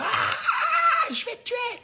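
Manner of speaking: hurlement caricatural très aigu; cri de rage imité. Retold or imitated. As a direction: singer un conducteur fou furieux. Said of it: "ils sont en mode". (cri aigu et exagéré d'un automobiliste enragé)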